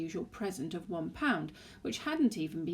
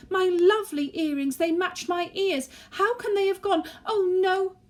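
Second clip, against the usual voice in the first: screech; 13 semitones higher, 10 dB louder than usual. Acted. Wife screeching at Gordon about her earrings, screeching. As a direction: shriek at her husband in panic. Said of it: "she screeched".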